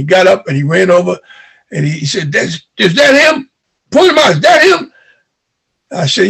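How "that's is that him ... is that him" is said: higher, rising, menacing demand; angry uncle. Retold or imitated. Uncle storming over to find the man, rising anger.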